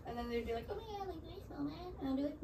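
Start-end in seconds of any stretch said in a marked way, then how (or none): 0.7-2.0 high, very soft self-conscious question; imitated worried friend. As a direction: act out a friend's small, anxious voice. Voices embarrassed friend's reaction.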